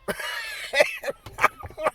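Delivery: high, squealing burst of laughter; real laughing fit. Real, not acted. Storyteller cracks up over the buck thirty claim, high laughter. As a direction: crack up helplessly at your own story.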